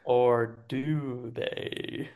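low, soft, very slow teaser; ominous tease. Pretend. Game master teasing the players' fate, low and slow. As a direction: drop it down as a spooky cliffhanger. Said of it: "ominous teaser".

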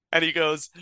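ordinary talk

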